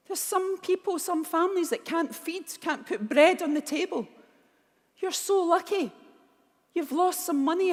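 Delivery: scolding mum. Retold or imitated. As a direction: shake some sense into your daughter. Mum scolding her on the floor.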